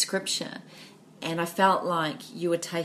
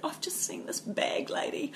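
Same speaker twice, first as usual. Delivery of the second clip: hushed retelling; 10 semitones higher, 5 dB quieter than usual. Retold or imitated. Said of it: "And she said".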